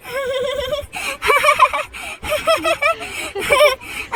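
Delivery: long, high giggling fit; acted mother-and-daughter laughter; laughing. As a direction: burst out laughing at the little one. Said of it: "हमारा और मालती हंसने लगती है".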